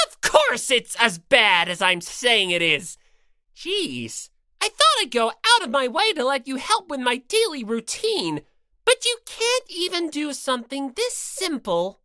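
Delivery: lightly voiced bossy bear mascot; Monokuma voice. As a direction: lecture her with smug cruelty. Monokuma complaining.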